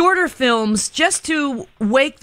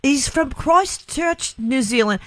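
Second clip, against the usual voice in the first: slow, high put-on Liverpool accent; 4 semitones higher, slower than usual. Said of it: "that was a Beatles Liverpool accent".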